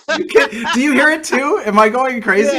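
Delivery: high laughing question. Real, not acted. Host asking cohost about the line, laughing high. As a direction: ask it while cracking up.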